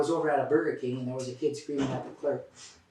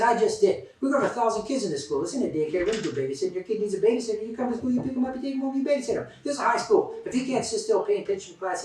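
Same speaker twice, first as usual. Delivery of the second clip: much higher, loud exasperated tirade; yelling at mom; 8 semitones higher, 5 dB louder than usual. Retold or imitated. School official shouts down the phone at a mother, much higher and loud. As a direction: let her have it, pitched up and shouting.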